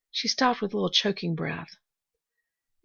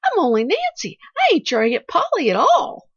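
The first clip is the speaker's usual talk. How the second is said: high, loud flustered denial; shocked terror; 11 semitones higher, 9 dB louder than usual. A narrator's reading. Nancy correcting the girl's mistake, alarmed. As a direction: deny it in alarm. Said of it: "turned into shocked terror".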